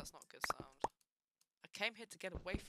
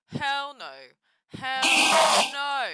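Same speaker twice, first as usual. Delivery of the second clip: loud and high; a sharp, rising hell no; panicked refusal; 9 semitones higher, 14 dB louder, slower than usual. Real, not acted. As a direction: yell it out in panic. Mob appears close.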